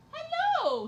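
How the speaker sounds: very high, loud happy greeting; delighted squeal. Real, not acted. Streamer greeting her cat running over, squealing. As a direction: squeal hello as she arrives.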